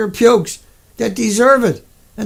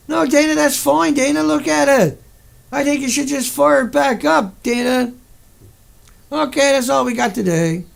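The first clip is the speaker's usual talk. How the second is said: higher, patronizing brush-off; mock dismissal; 5 semitones higher than usual. Mocking officials telling Dana to restart the plant, higher patronizing tone. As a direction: sneer like an official waving it away.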